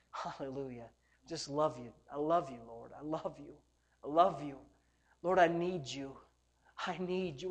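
soft, low devotional voice; short hushed phrases with space between them; intimate prayer. Real, not acted. Preacher tells the Lord his need, soft low prayer.

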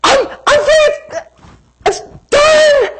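very high, stretched-out wail; hammed-up tearful cries of relief; imitated joyful crying. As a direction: wail it slowly like an overwhelmed girl. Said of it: "And she's crying and crying".

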